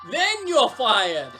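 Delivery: slow, loud firing; loud, emphatic boss; shouted dismissal. Acted. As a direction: draw it out and shout it. Boss calls Ned back, refires.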